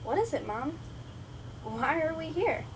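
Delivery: a hint of an insistent child; asking child. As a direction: recall your eight-year-old questions to Mom. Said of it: "And I kept asking".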